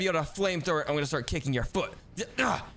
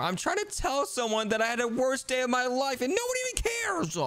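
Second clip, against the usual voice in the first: whiny, rising in pitch; upset complaint; 6 semitones higher than usual. Pretend. Sibling ignored after the worst day, whiny complaint. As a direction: complain higher and higher to a wail.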